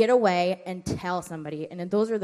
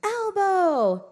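mild mock kid answer; 11 semitones higher, 4 dB louder than usual. Kids answering.

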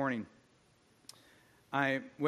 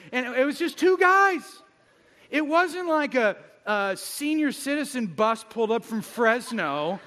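much higher, much louder comic outburst; loud incredulous storytelling; exasperated rant; 8 semitones higher, 7 dB louder than usual. Acted. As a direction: rant in mock outrage.

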